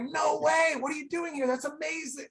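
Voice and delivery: high-pitched, excited fan gush; giddy re-enacted fanboy excitement. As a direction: act out amazed delight, voice way up. Acts out meeting an obscure idol.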